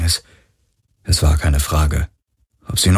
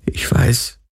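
tearful; 5 semitones higher than usual. Acted. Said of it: "Tränen verschleierten seine Sicht".

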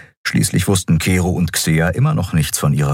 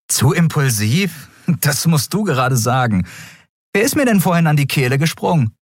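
higher, hesitant question; Endriel, higher voice; 10 semitones higher than usual. A narrator's reading. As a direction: ask carefully, in a higher voice. Endriel retorts. (Endriel fragt Kero zögernd, höhere Stimme)

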